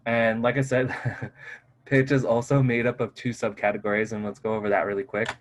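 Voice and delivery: ordinary talk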